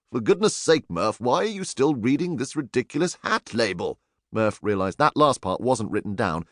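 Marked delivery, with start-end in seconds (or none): none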